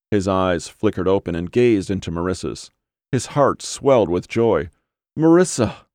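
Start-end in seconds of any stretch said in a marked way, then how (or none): 5.2-5.9 higher, louder cry of happy surprise; joyful cry. A narrator's reading. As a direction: call her name with a burst of joy. Joyful cry on seeing her.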